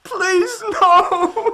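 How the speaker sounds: high, loud, terrified cry; panicked plea. Acted. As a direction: cry out as they take you away. Accused man being arrested.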